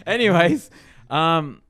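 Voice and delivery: loud, high laughing. Real, not acted. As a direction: burst out laughing mid-word. Laughing burst.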